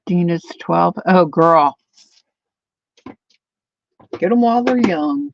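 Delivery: usual speaking voice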